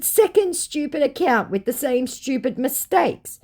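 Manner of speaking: high, slow, scornful put-down; snooty high voice. Retold or imitated. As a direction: talk down to him loftily. Her own retort, acted.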